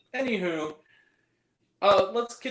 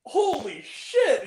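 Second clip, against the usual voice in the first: high, drawn-out exclamation; slow, high movie-quote shock; acted shock; 9 semitones higher, 4 dB louder, much slower than usual. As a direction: stretch it slowly in mock astonishment. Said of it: "where he's like".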